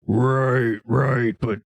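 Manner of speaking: a hint of a wary ogre, slow; lightly voiced ogre pressing for answers. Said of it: "He nods slowly, then says".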